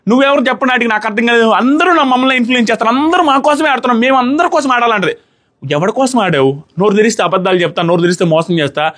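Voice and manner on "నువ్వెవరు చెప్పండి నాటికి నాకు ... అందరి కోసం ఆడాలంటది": higher mock yell imitating her; a retold shout at Nikhil, pitched up. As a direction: raise the pitch and half-yell her words. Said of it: "Nikhil మీద అరుస్తుంది".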